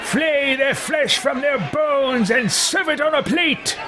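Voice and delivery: slow, high, hammed-up villain shout; vicious game character, relishing cruelty. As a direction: draw out the bloodthirsty decree, rising high. Plays his vicious character.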